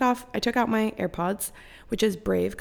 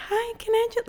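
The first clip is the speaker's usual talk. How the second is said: way higher, sing-song polite; customer service voice; 14 semitones higher than usual. Retold or imitated. Podcaster ordering room service in a very high customer service voice. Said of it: "customer service hoe voice".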